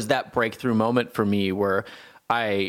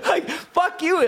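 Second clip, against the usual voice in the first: much higher, slow laughing outburst; laughing mock rant. Venting at the counselor, hammed-up laughing rage. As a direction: drag out the curse through laughter. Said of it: "sarcastic and yelling at him".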